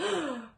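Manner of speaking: a quick, high startled gasp; sharp gasp. Acted. Red startled by the wolf's big eyes, sharp gasp. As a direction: catch your breath in a sudden gasp. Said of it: "Red gasped".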